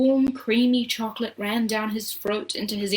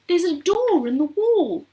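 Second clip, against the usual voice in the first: high, loud discovery; excited cry; 9 semitones higher, 5 dB louder than usual. A narrator's reading. Charlie telling Grandpa about the door, excited cry. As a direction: call it out in wonder. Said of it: "cried Charlie".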